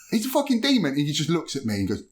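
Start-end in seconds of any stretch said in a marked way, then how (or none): 0.1-0.9 excited. Retold or imitated. Emphatic retelling, high and loud.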